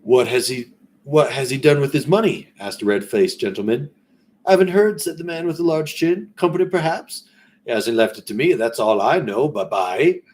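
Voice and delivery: a hesitant, high Cockney try; lightly voiced Cockney merchants, loud and high; Cockney attempt. Businessmen gossip about the dead man's money, in an attempted Cockney. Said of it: "gotta try to get my Cockney going".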